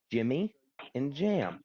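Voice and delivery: "Jimmy... and Jam", broken up with a pause; imitating someone repeating "Jimmy and Jam" slowly; slowed-down retold name. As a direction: spell out "Jimmy and Jam" piece by piece. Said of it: "Say it slow".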